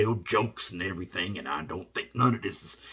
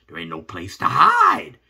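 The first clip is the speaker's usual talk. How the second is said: usual speaking voice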